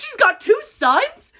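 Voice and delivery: high, loud, slow disbelieving rise on two; stunned that the character has sons; shocked question. Real, not acted. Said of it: "shocked question".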